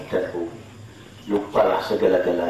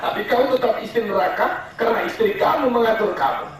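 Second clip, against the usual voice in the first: very high, laughing ridicule; mock the henpecked husband; preacher sneering at men ruled by wives; scornful mockery; 3 dB louder than usual. Real, not acted.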